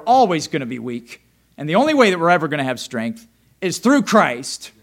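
loud, rising preacher's cry; fiery preaching. Acted. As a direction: preach it out with fire. Preaching at full force.

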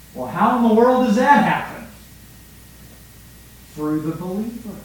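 ordinary talk, nothing marked

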